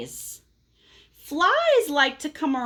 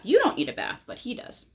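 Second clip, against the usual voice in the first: ordinary delivery, nothing marked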